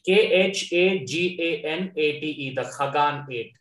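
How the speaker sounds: usual speaking voice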